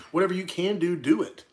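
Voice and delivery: ordinary delivery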